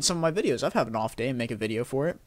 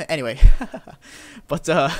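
real laughter; 7 semitones higher, 8 dB louder than usual. Real, not acted. Laughs at own hand gestures.

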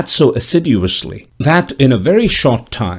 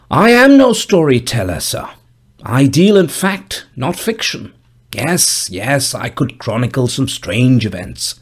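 put-on old gravedigger voice; 2 semitones higher than usual. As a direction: speak as a dour old gravedigger, raised pitch. Gravedigger begins his tale.

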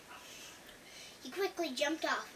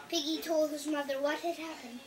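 ordinary delivery, nothing marked

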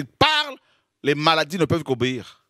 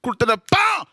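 mock thunder bam; 8 semitones higher than usual. Imitated thunderclap again.

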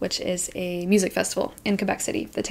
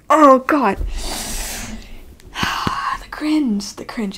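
breathy whisper, pitched up; whispered cringe; 5 semitones higher, 4 dB louder, slower than usual. Real, not acted. Reliving the spill for viewers, pained whisper. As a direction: hiss it like it hurts.

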